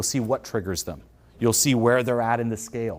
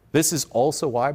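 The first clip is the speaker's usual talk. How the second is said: ordinary talk, nothing marked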